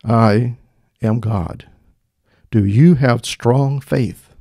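low, slow, booming God voice. Acted. God questioning the hanging man, deep, slow and booming. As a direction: proclaim it deep, loud and drawn out. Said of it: "The voice said".

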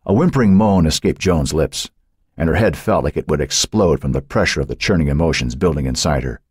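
ordinary delivery, nothing marked

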